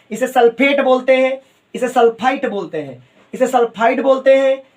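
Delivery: ordinary delivery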